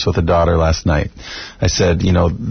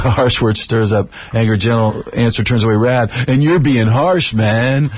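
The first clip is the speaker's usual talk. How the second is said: surfer dude voice; 5 semitones higher than usual. Retold or imitated. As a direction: play a stoner surfer turtle. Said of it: "with the surfer turtle voice from Finding Nemo".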